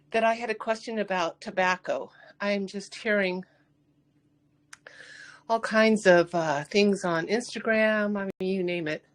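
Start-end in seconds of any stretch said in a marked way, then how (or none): none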